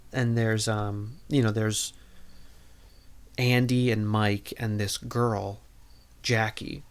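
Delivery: usual speaking voice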